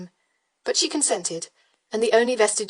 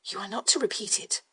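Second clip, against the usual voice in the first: softer, confiding; lowered voice; 5 dB quieter than usual. Acted. Widow confiding to Brandon, voice lowered. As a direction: drop your voice to share a secret. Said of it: "lowering her voice".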